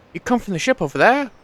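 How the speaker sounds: put-on Welsh accent. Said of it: "Welsh accent".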